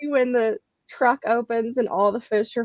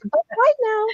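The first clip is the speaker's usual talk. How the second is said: high doll-like bye-bye; sing-song toy doll goodbye; mock Barbie farewell; 10 semitones higher than usual. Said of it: "like Toy Story with the Barbie".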